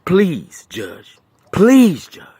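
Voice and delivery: begging a judge on his knees; desperate begging. Real, not acted. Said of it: "So I'm begging you".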